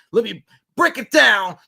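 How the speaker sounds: high, loud showy announcement; mock analysis. Mocking keen film analysts, hammed-up high and loud. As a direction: launch into a hammed-up breakdown.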